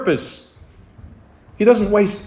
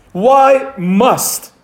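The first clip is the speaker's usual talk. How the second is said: "must" set apart and weighted, pitched up; stress on must; 4 semitones higher than usual. Real, not acted. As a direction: lean firmly on the word "must". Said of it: "the emphasis is on the word must".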